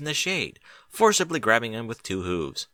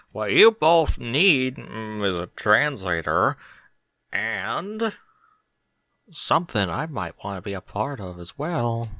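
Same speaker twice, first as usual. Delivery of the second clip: teasing; slower than usual. A narrator's reading. Barry offering herself to the pair, slow sultry tease. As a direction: tempt them, taking your time. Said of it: "teased Barry".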